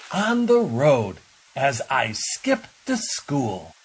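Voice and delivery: put-on high, loud, bouncy child; a boy who loves puddles; excited boy. A narrator's reading. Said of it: "shouted Ted".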